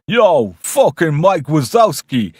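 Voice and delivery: loud, high, stretched Brooklyn yell; hammed-up Brooklyn holler; Brooklyn shout. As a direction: bellow it in a Brooklyn accent. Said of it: "shouting him in a Brooklyn accent".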